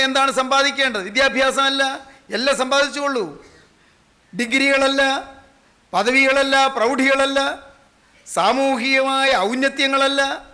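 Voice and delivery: full-voiced, insistent pulpit delivery; fervent preaching. Real, not acted. Preacher telling congregation only Christ is worth gaining, fervent exhortation. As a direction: hammer out each denial in a sustained ringing voice.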